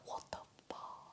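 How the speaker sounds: barely audible whispered disbelief; tiny, voiceless aside; stunned whisper. Retold or imitated. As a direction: mouth it under your breath, stunned. Stunned aside after his exit.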